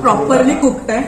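chewing while talking; speaking between bites; mouth partly full. Real, not acted. Seen 0: Bit sandwich just before; lips closed at +0.8; hand near mouth.

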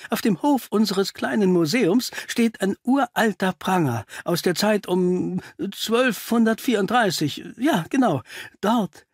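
high-pitched, distressed report; shaken Doctor Pickley. Acted. Pickley reports finding the body to Holmes, shaken. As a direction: pitch climbing towards a faltering halt.

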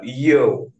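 loud drawn-out "yo" catchphrase; lightly voiced Jesse, punchy opening yo; Jesse impression. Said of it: "When Jesse speaks, he speaks with that".

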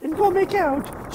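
high, loud, giggly princess. Pretend. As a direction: urge him on, giddy damsel. Voicing Peach, laughing.

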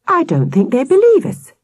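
put-on high-pitched, put-out child; protest, voice climbing high; indignant. A narrator's reading. Victoria feeling disbelieved, high and indignant. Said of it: "said Victoria indignantly".

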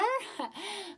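high amused laugh. Real, not acted. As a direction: laugh at the tigers. Laugh after the joke.

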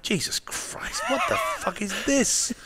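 half-whispered, laughing disbelief; retold inner reaction, whispered through a laugh; stunned disbelief. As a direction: breathe it out in amazed, laughing disbelief. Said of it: "And I'm like".

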